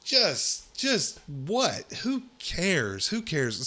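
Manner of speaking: high, incredulous exasperation; exasperated disbelief. Real, not acted. Host reacting to the Thanksgiving article, exasperated disbelief. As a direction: pitch jumping up and falling on each burst.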